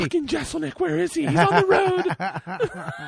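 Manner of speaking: lightly voiced Amy fuming; Amy ranting about Jeselnik; mock angry Amy. Mocking Amy's rage.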